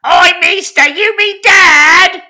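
put-on very high, loud, slow squeaky Cockney kid; a shrill Cockney street urchin, squeaking it out. Cohost hamming up his alter ego, a high Cockney urchin. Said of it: "regressed into some alter ego".

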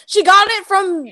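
loud mock answer. Real, not acted. Friend shouting the answer, high and slow. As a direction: yell the obvious answer.

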